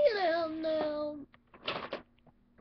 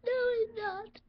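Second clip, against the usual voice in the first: crying denial; 7 semitones higher, slower than usual. Acted. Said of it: "You're crying".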